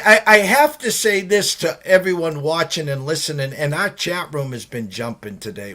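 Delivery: ordinary delivery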